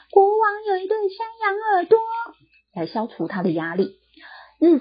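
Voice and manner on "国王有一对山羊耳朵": high shout to release stress. Acted. Barber shouting into the empty field. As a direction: yell it out to let off pressure. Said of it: "大喊".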